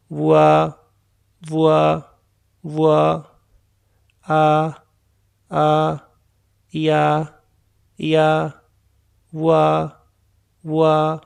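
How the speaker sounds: "वुआ" as "वू" gliding into "आ"; blended ua. Shown as a demonstration. Teacher models the blend "वुआ" for students. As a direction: merge "वू" and "आ" into one "वुआ". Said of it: "दोनों को जोड़ें तो क्या बनता है".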